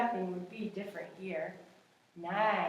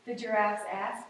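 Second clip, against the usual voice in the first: ordinary delivery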